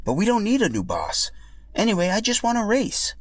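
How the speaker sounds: just want to race; earnest Yoshi. Acted. Yoshi declines being boss.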